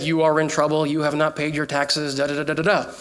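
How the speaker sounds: low robotic phone message; robocall voice. Retold or imitated. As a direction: mimic a stern recorded message. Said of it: "It said".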